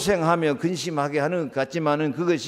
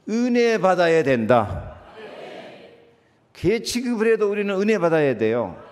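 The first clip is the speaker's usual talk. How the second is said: emphatic declaration; 5 semitones higher, 4 dB louder than usual. Acted.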